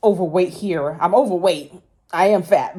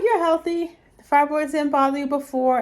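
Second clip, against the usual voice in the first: mock self-reassurance; 7 semitones higher than usual. Quoting her own past reasoning.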